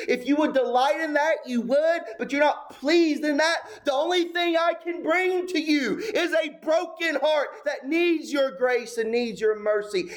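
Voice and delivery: high, earnest prayer voice, pitch lifted well above his preaching; pleading prayer. Acted. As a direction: plead with God from an empty-handed heart.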